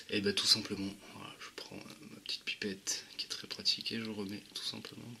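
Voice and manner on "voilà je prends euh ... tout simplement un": whisper. Real, not acted. Whispering while dripping juice.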